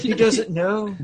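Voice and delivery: playful dotty brogue; Missus Doubtfire. Acted. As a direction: say it in a mock Celtic lilt. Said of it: "now you're Missus Doubtfire".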